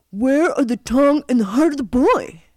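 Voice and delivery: loud, high villain's demand; storybook villain calling for proof; demanding scoundrel. A narrator's reading. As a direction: demand it like a wicked old man.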